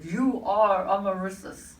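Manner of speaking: a loud, slow booming proclamation. Retold or imitated. The voice in the pyramid names her, booming loudly. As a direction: boom out the name like a voice from above. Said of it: "but it was booming".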